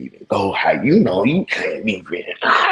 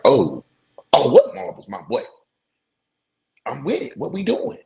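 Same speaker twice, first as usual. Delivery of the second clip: competitive hype; 7 semitones higher than usual. Retold or imitated. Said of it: "my competitive ass like".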